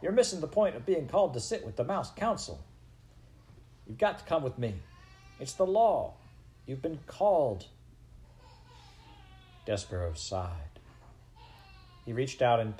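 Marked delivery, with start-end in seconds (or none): 0.0-7.7 higher-pitched, impatient telling-off; exasperated scolding. Acted. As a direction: scold him with big swoops in pitch. Exasperated brother scolding Despereaux.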